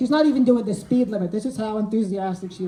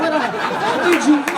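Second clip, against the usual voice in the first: gushing thanks; 4 semitones higher, 5 dB louder than usual. Retold or imitated. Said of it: "but I'm like".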